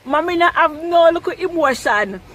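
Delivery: usual speaking voice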